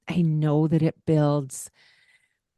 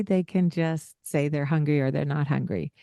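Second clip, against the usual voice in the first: ordinary delivery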